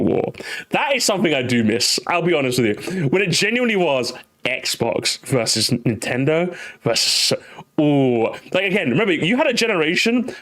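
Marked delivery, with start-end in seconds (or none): none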